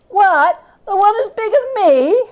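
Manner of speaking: high, lightly voiced street boy; surprised boy. The boy amazed at the turkey's size, lightly voiced. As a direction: react in cheeky astonishment.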